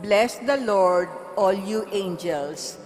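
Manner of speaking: ordinary delivery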